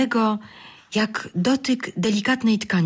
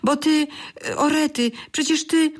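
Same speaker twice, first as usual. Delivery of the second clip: stammering excitement; 9 semitones higher than usual. A narrator's reading. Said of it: "zaczęły mówić równocześnie".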